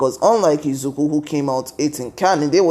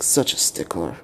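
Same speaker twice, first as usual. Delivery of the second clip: muttered aside; 10 semitones lower, 6 dB quieter than usual. Retold or imitated. Both mutter about Ida.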